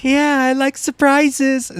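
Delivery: put-on high, slow kid voice; put-on little kid, slow and high; meme kid voice. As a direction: drag it out in a high childish voice. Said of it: "Like that one meme of the kid".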